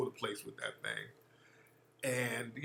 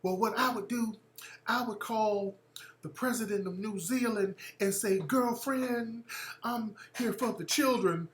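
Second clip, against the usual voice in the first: Marianne Williamson; 6 semitones higher, 3 dB quieter than usual. Retold or imitated.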